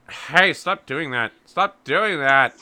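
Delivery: loud, slow, drawn-out protest; protesting sister. Acted. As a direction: complain loudly, stretching the words. Sister protests at being nudged.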